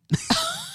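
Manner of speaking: a very high, quick squeal of a laugh; squeaky laugh. Real, not acted. Reacts to the name Shithead.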